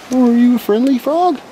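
cutesy voice to a frog. Pretend. Talking to a frog in a baby voice.

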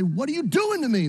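a man demanding answers from God, anguished; anguished cry to God. Retold or imitated. Job crying out to God.

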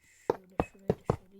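usual speaking voice

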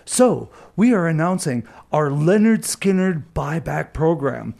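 formal radio promo read; station announcement. Acted.

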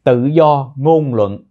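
very slow, deliberate phrase; slow teaching read. Shown as a demonstration. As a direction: spell it out slowly for learners. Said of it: "tự do ngôn luận các anh chị nha".

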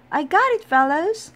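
Amelia calls to her teammates after catching the ball, high loud call. A narrator's reading. Fielder calling to the team, triumphant high shout. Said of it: "she called".

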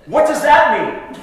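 high, loud laughing question; mock kid voice breaking into laughter; curious kid. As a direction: blurt it like a nosy boy. Punchline, kid asks preacher's watch.

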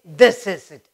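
THIS hit loud in "This is it"; emphatic. Shown as a demonstration. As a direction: punch the word "This" to emphasize it. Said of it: "emphasizing something. You want to say".

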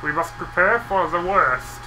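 slow, put-on German accent; Mister Bean's German accent. Player warning of what is coming in a game, mock German accent. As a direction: do a comic, drawn-out German accent. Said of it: "sounded like Mister Bean doing a German accent".

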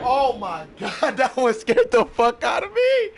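laughing off a jump scare; shaky laugh after a fright; relieved laughter. Real, not acted. Jump scare in horror game.

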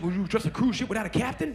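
ordinary talk, nothing marked

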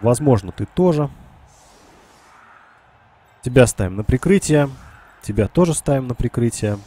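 ordinary delivery

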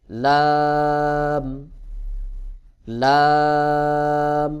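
লাম with tongue edge on upper gums, held very long; long, sustained recited লাম. Shown as a demonstration. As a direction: draw out লাম loudly on one level tone. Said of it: "লাম উচ্চারণ হবে".

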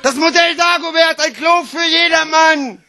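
high, exclaimed sales proclamation; bombastic mock advert. Slam poet hailing a toilet model, bombastic proclamation. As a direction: proclaim the product as if saving the world.

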